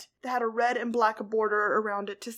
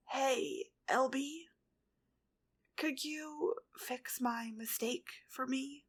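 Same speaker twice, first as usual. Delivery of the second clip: weak; 3 semitones higher, 5 dB quieter, slower than usual. A narrator's reading. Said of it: "He still sounded weak".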